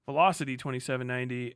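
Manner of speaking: usual speaking voice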